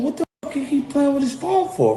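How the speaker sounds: retold annoyed thought. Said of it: "I'm going".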